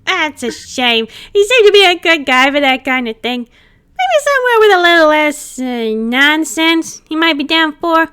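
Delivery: high, loud, cheeky ghost voice; cheeky ghost buddy, excited; playful ghost chatting with his pal; playful spirit. Acted.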